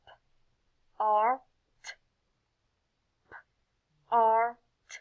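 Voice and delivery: "p, r, t" as isolated phonics sounds; sounded out. Shown as a demonstration. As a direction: pause after each of "p, r, t".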